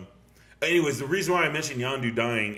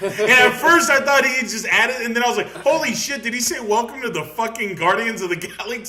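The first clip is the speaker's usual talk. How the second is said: high, loud and laughing; bursting with delighted disbelief; near-shouted giddy retelling; excited laughing; 11 semitones higher, 10 dB louder than usual. Real, not acted.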